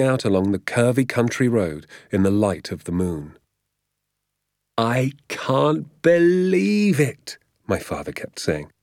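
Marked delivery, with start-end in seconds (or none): 4.8-7.4 high, drawn-out, marvelling cry; elated disbelief. A narrator's reading. Father to son after the night's adventure, elated disbelief. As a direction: stretch the words out in joyful disbelief.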